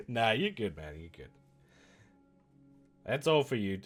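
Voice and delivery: ordinary talk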